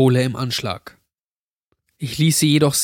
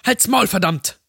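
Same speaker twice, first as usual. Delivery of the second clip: higher, mildly shouted outburst; angry yell; 8 semitones higher than usual. A narrator's reading. Stephanie orders him to shut up, yelling angrily. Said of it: "Stephanie schrie ihn an".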